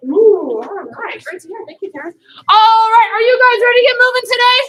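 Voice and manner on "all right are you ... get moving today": loud, high call to the class; rallying shout. Real, not acted. Instructor rallying her karate class, loud and high. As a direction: pump up the room with a big shout.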